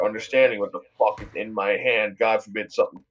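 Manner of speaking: ordinary delivery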